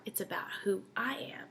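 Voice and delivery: hushed, breathy aside; whisper. Real, not acted. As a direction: drop to a whisper to make it land. Whispered emphasis in sermon.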